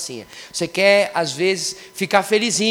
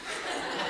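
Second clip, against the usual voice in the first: breathy laugh; 13 dB quieter than usual. Real, not acted. Laughs at own story.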